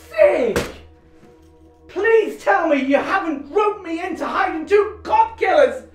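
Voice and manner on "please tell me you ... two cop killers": horrified shout. Acted. Gary shouts at the two killers, horrified. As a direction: cry out in disbelief.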